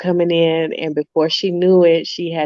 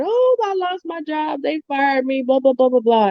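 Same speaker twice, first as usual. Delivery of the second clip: boo-hoo crying; 7 semitones higher than usual. Retold or imitated. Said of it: "she was boo-hoo crying".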